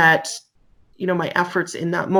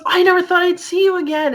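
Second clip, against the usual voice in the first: put-on high, bright-eyed young man; excited youth; 12 semitones higher, 3 dB louder than usual. Young man recognizing the officer, excited and high. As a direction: gush with disbelief. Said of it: "he got so excited".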